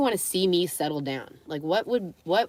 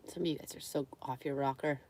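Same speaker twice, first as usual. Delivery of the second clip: whisper; 5 semitones lower, 12 dB quieter than usual. Real, not acted. Whispered aside to viewers.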